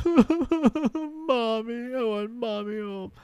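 put-on high, slow wailing little-kid voice; imitated crying toddler. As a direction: whimper it like a tired toddler. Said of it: "She's crying".

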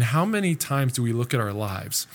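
usual speaking voice

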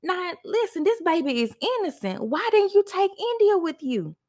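high, pleading retold panic to God; hysterical prayer. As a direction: beg the Lord frantically, voice climbing. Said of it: "I'm hysterical".

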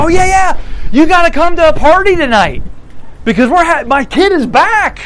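a thrilled dad bursting with news; overjoyed parent. Acted. Overjoyed parent invites to party.

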